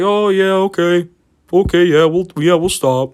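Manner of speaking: high-pitched, eager mock compliance; placating agreement. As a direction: imitate teens readily promising to behave. Teens placating the worker.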